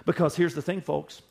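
ordinary talk, nothing marked

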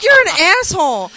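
high, loud mock-outraged yell; retold yelling. Fiancée reacting to a graveyard proposal, retold indignant yell. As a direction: throw the insult at him, high and loud. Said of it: "as I'm yelling at him".

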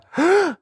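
high dramatic gasp; shocked gasp. Acted. Confessing to viewers with a mock-shocked gasp.

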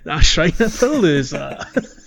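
slow, loud, drawn-out showman voice; mock grand. Echoing co-host's silly name, mock grand announcement. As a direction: announce it like a festival.

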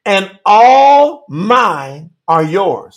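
very slow, weighty scripture reading; slow solemn verse reading; read real slow. Shown as a demonstration. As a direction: lay each word down slowly. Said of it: "read this real slow".

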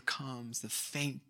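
very soft, nearly fading away; hushed. Real, not acted. Preacher describing God's whisper amid life's chaos, hushed. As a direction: let it drop to a faint hush.

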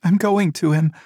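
mother insists on going to her dead son, desperate; insistent mother. A narrator's reading.

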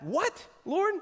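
high, disbelieving what Lord; mock shocked question to God; incredulous. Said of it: "her reaction was just that".

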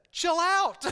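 loud, high calm-down command; a divine voice telling him to relax; Holy Spirit's voice. Pretend.

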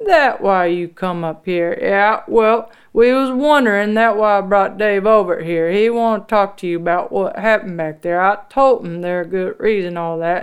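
loud fakey voice. A narrator's reading. Husband covering up before Officer Dave, loud fakey voice. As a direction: talk real loud and falsely for an onlooker. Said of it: "Frank start talking real loud, you know, using his fakey voice".

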